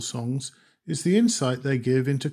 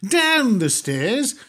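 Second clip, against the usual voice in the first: slow, higher, louder incredulous cry; dismayed disbelief; 8 semitones higher, 6 dB louder, much slower than usual. Acted. Customer sent downstairs yet again, incredulous. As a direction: drag it out in disbelief.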